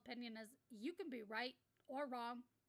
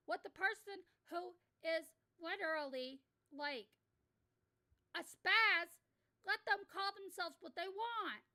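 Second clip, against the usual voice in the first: loud rant; 5 semitones higher, 7 dB louder, slower than usual. Real, not acted. Ranting about word policing.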